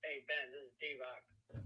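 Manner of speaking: ordinary talk, nothing marked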